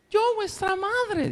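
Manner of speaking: very high, slow sweetened voice; wolf's fine voice. Acted. Wolf at the door imitating the mother in a high, slow fine voice.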